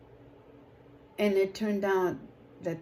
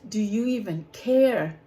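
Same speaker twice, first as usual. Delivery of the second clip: echoing the plea; 6 dB louder than usual. Retold or imitated. Recalling her question to God.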